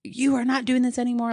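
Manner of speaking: imitated scolding yell. Mom scolding her crying daughter, mock yell. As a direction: imitate a mother telling off her child. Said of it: "she's yelling at her".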